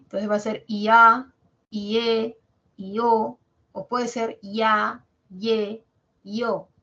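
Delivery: ya, ye, yo, with the open vowel stronger; diphthong drill. Shown as a demonstration. Teacher drilling the syllables for the class, emphatic. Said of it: "lo dices junto o lo separas un poquitito".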